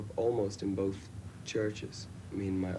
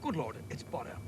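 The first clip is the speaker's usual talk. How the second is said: put-on posh British accent; 2 semitones higher than usual. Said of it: "quite a well-to-do accent".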